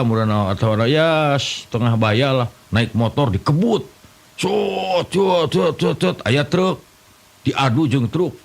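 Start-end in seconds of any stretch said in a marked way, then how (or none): 4.4-6.2 high, quick imitated engine sputter; mock the bike with high cot cot; motorbike noise. (tiruan suara motor tinggi)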